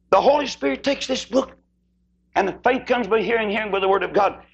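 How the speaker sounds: high, ringing preacher's cry; Southern revival preaching swell. Real, not acted. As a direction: drive it home from the pulpit. Preaching at full force.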